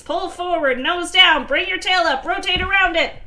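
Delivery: high-pitched string of commands; urgent instructions. Acted. Barry guiding the pilot down, urgent high commands.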